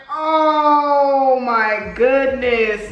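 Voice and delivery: mock-dramatic dismay, very slow; exasperated wail. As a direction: stretch it into a hammed-up wail. Reacting to demand for phone.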